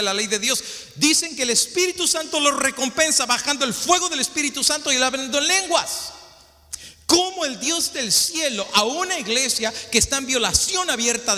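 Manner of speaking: high, rising fervent sermon cry; preaching at full force. Acted. Preacher condemning a perverse doctrine to the congregation, preaching at full force. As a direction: preach it hard to the whole church, pitch climbing.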